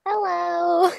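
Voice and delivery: put-on high, soft anime-girl hello; sweet little kawaii greeting; cute kawaii voice. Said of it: "that was so kawaii desu".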